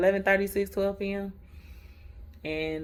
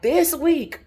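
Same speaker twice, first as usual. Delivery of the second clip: preacher quote; 7 semitones higher, 6 dB louder, slower than usual. Retold or imitated. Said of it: "And he was like".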